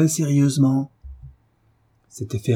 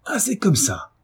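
high, furious exclamation; angry outcry, pitched up; 10 semitones higher than usual. A narrator's reading. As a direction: burst out as an enraged husband. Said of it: "s'écria le colosse furieux de la résistance".

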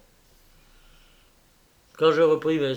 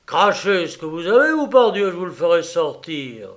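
loud, raised demand; angry threat; 4 semitones higher, 7 dB louder than usual. Acted. Rosenthal threatens Raffles, loud and angry. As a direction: force the truth out.

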